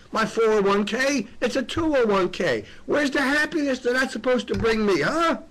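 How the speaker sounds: impassioned near-shout; emotional outburst. Real, not acted. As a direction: let it boil over into a pleading rant. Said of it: "I'm sorry for getting emotional".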